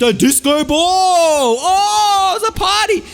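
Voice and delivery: high, loud, thrilled shout; excited reveal. Acted. Storyteller reveals the disco ball, shouting high with glee. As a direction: burst out with the big reveal.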